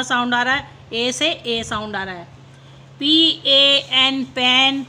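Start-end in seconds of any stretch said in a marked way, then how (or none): 3.0-4.2 slow letter-by-letter spelling. Shown as a demonstration. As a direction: spell ए पी slowly, each letter apart. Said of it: "teacher spells word slowly".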